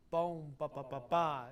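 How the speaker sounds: "bohm" held into "ba, ba, ba, ba"; sustained "bohm", triplet leaning into final "ba"; leading to downbeat. Shown as a demonstration. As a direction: connect "bohm" through the triplet to the last "ba". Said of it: "I want you to go".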